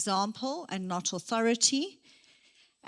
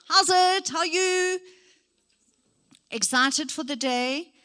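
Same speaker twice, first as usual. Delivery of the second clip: much higher and louder, bursting with energy; over-the-top excited greeting; 7 semitones higher, 6 dB louder than usual. Shown as a demonstration. Trainer showing an overly enthusiastic greeting, high and loud. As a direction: gush it like a hyper cheerleader. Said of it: "if you're doing that all the time, then that's also painful".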